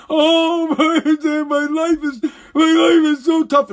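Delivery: fake-cry it like a spoiled billionaire; mock sobbing whine. Mocking a rich guest whining to Oprah, fake sobbing. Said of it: "they're talking about how".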